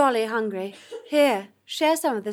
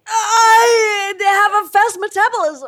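hammy wail; 6 semitones higher, 10 dB louder, slower than usual. Acted. Skeleton figure insists loudly.

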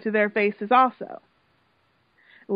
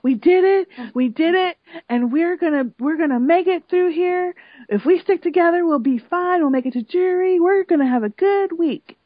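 higher, louder upbeat rallying of the housemates; excited pep talk; 6 semitones higher, 4 dB louder than usual. Retold or imitated. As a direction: pump the group up, imitating Helen. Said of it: "she was excited".

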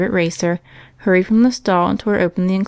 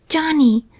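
ordinary delivery

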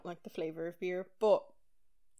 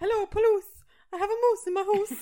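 high, loud laughing frantic caller; mock panicked emergency call; 13 semitones higher, 13 dB louder than usual. Mocking the 911 call.

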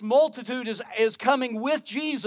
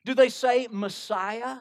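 usual speaking voice

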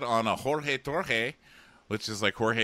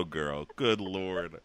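usual speaking voice

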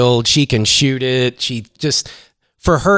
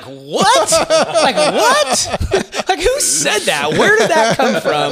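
high, laughing what, what; laughing disbelief; much slower than usual. Retold or imitated. Wife hears of recruiting, laughing disbelief. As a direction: squeal in amused disbelief, much higher. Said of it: "I was like".

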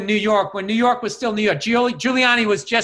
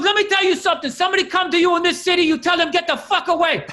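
mock yelling cab driver; 9 semitones higher, faster than usual. Cab driver lecturing the passenger about city hustlers, high and fast. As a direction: lecture him hard, the way an angry cabbie would. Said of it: "he was just yelling at me".

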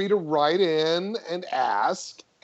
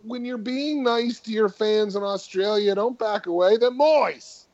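mock Australian; 7 semitones higher than usual.

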